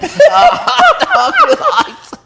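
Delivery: high, slow words through laughter; laughing squeal. Real, not acted. Laughing at the frozen hot dog, high laughing squeal. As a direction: squeak it out while cracking up.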